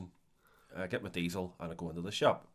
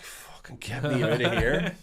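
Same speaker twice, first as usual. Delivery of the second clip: slow, breathy whispered plea; stuck with a weird stranger, whispered slow aside; 3 semitones higher, 3 dB louder, slower than usual. Retold or imitated. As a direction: whisper it like you're trapped. Inner thought, whispered.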